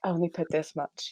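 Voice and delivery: hushed, unhurried mock instruction; mock ancestral whisper. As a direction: voice ancestors quietly guiding your hand. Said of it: "They whisper".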